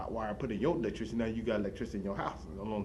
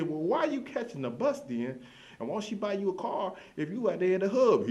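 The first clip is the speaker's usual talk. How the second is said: much higher and louder, disbelieving; incredulous; 10 semitones higher, 4 dB louder than usual. Retold or imitated. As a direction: shoot back in loud disbelief. Challenging the hub guy.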